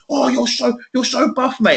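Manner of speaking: high-pitched mock gushing; gushing admiration. Admirer to the bodybuilder, retold gushing.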